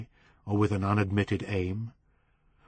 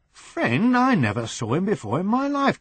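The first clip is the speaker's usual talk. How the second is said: higher, rising disbelief on friend; shocked denial, higher; indignant surprise; 9 semitones higher than usual. Acted. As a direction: protest he's never met the man. Brian protests, disowning Potter.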